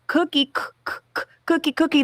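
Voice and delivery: high, insistent repeated prompting; mock nagging parent stuttering out the word; pushy prompting parent. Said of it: "trying to get them to say cookie".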